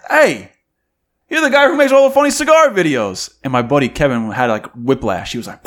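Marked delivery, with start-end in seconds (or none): 0.1-3.3 high, loud excited stranger voice; excited fan. Retold or imitated. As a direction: play the starstruck fan in the bathroom. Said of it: "He goes".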